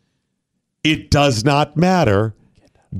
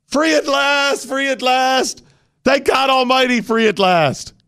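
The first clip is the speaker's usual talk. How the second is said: much higher, slow, booming sermon cadence; rousing preacher; 9 semitones higher than usual. Retold or imitated. Host hams up relief at a trooper's tweet, preacher's ringing cry. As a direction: proclaim it like a preacher at a rally. Mock-rousing civil rights quote.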